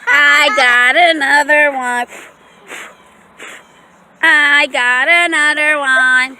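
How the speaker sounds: slow, loud teasing call; slow sing-song tease for the dog; drawn-out tease. Real, not acted.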